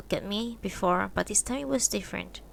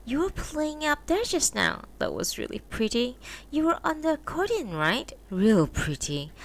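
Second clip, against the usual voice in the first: a hint of a higher woman's voice, teasing; lightly voiced wife; 7 semitones higher than usual. A narrator's reading. Wife teasing her husband about his new friend, lightly voiced. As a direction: voice the wife a little higher, mildly mocking.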